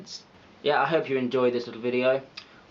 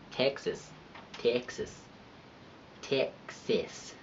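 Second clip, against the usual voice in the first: softer attempts at a Texas accent; 8 dB quieter, slower than usual. Vlogger who would not belong in Dallas, practising a Texas accent. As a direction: keep testing a shaky-fit Texan accent on one word. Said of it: "I would not belong in Dallas".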